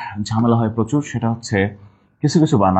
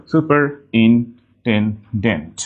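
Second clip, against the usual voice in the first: slow "superintendent": super, in, ten, dent; broken into syllables; much slower than usual. Shown as a demonstration. Teacher making spelling clear, "superintendent" slowly in pieces. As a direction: pause between each piece of "superintendent". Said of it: "ভেঙে নিলে clear হয়ে যাবে".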